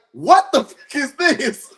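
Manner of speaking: high, drawn-out pretend disbelief; mock astonishment. Acting the co-host opening the check, mock astonished. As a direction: stretch it out high in playful shock.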